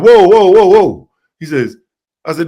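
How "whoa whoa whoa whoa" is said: high, loud retold whoas; re-enacted halt, voice jumping up; loud interjection. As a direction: cut someone off with a loud string of whoas. Acts out stopping the caller.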